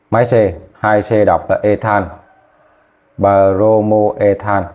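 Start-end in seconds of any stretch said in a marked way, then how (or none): 3.2-4.6 Bromoethan stretched out, every syllable separate; slow clear naming. Shown as a demonstration. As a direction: say Bromoethan slowly so the class can copy. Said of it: "Nhớ nha, nhớ có chữ O nha".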